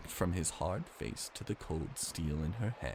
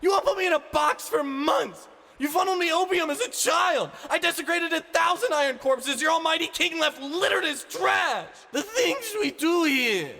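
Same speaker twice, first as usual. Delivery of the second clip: very high, loud screamed rebuke; enraged shouting girl; furious scream; 9 dB louder than usual. Acted. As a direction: scream it down at him. Ani screaming accusations.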